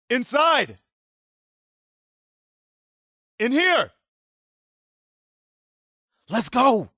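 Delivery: high, urgent calls to get inside; urgent shouts. Acted. Soldier herding survivors indoors, calling out urgently. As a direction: hurry everyone through the door. Urging people inside to safety.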